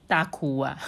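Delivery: usual speaking voice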